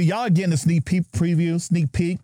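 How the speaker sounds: ordinary talk, nothing marked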